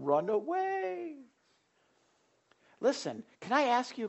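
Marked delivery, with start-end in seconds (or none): 0.0-1.2 high, slow, softer comic run away; softer mock retreat. Christians fleeing trouble, softer high drawn-out mock. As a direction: let it trail off high and soft. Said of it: "That's the motto".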